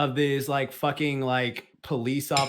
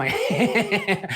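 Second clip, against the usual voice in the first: imitated laugh; 5 semitones higher than usual. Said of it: "and he starts laughing".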